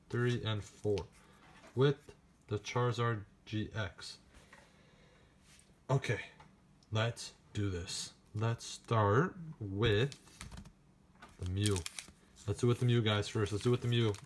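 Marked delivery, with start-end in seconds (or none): none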